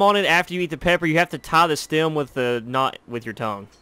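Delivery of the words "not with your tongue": ordinary talk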